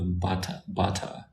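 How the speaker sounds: "bata" with a clear t, lightly imitating a London accent; London pronunciation. Shown as a demonstration. Showing viewers how London says butter, a light London accent. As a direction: say "bata" the London way, t fully sounded. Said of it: "they say bata".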